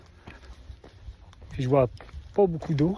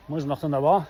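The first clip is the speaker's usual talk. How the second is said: ordinary delivery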